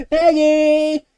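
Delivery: calling out. Acted. Maya calling out again.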